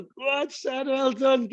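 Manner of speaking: high-pitched shout of encouragement to a mate; cheering on. Acted. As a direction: egg a gym mate on, voice lifted high. Acted gym cheering on a mate.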